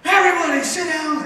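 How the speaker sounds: sudden high, loud angry order; coach snapping. Retold or imitated. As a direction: bark it at the whole room like a furious manager. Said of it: "He comes in and snaps on us".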